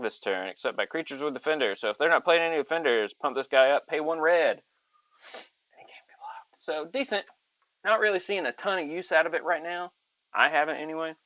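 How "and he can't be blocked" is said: whisper. Acted. Secretive aside about unblockable.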